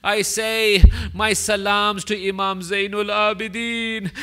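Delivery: ordinary talk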